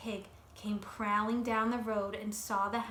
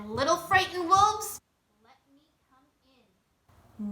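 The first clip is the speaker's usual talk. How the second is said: menacing pig; 5 semitones higher, 9 dB louder than usual. Acted. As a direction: taunt the scared wolves. Pig at the door.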